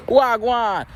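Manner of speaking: high, drawn-out Jamaican greeting; imitated Jamaican-accented hello; attempted Jamaican accent. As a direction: have a go at uncle's Jamaican accent. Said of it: "I can't do an accent".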